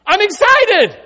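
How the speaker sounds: high, loud joyful cry; excited reply. Retold or imitated. Kayla answering why she is excited, joyfully. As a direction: burst out with delight. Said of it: "when she gets excited".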